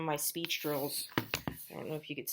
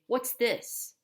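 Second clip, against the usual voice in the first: put-on asking child, higher; 6 semitones higher than usual. Shown as a demonstration. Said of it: "you could say".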